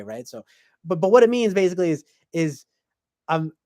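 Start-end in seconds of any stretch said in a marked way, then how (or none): none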